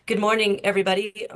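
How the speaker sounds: normal talking voice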